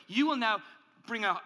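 ordinary talk, nothing marked

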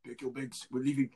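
subdued imitation of a New Zealand accent. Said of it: "what accent is that?".

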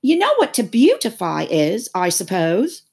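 high, loud quizzing; incredulous creature voice; scornful Gryphon. A narrator's reading. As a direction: challenge the child loudly.

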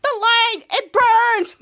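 high, loud creature cry; hammed-up agonized yell; mock screaming. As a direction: cry out like a mole person burned by light. Cut to all of them screaming.

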